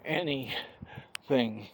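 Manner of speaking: ordinary talk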